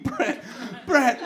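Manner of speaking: high calling of the name; imitated yelling. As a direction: imitate her still yelling. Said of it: "she's still yelling".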